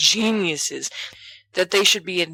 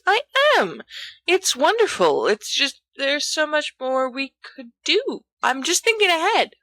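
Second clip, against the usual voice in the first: put-on much higher earnest boy's voice; a friend insisting he is on board; objecting Remus; 10 semitones higher than usual. A narrator's reading.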